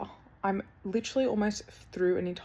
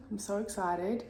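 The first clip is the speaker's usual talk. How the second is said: ordinary delivery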